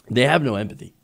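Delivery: normal talking voice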